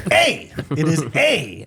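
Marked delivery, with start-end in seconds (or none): 0.1-0.5 "A" said high with a Minnesota vowel. Shown as a demonstration. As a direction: ring out "A" the Minnesota way. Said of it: "we're A".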